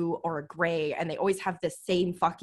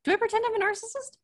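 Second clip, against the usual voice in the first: high, fast disbelieving question; can't believe what she's hearing; incredulous; faster than usual. Real, not acted. Repeats question, much higher, faster.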